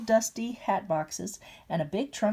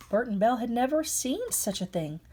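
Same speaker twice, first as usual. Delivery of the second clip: ordinary delivery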